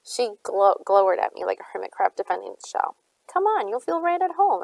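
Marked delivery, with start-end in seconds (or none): 3.2-4.6 lightly teasing, higher-pitched; mild, sing-out tease. A narrator's reading. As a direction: coax with a playful lift in pitch. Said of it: "I teased".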